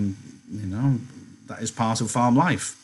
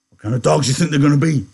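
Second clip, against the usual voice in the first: high, quick, loud voice; loud, curious big man; 6 semitones higher, 6 dB louder, faster than usual. Acted. Big man quizzing Slim about the pups, quick and loud. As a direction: fire off the question, pitched up.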